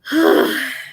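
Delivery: audible breathy sigh. Real, not acted. Patient waiting for test results, sighing. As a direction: let out one big breath.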